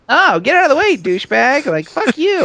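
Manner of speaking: high, loud action-heroine taunts; mock tough catchphrases. Mimicking a game heroine's catchphrases.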